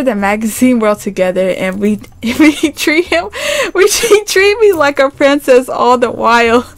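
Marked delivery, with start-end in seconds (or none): 2.2-6.7 giggles break into the words; laughing through the line, voice jumping high; laugh-read it, pitch jumping up; laughing while reading. Real, not acted. Laughing through a line she reads.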